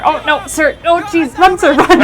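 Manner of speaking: high, loud mock-panicked urging; playful alarm. Player warning a game pedestrian to flee, playful alarm. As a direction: warn a stranger off, rising to a shout.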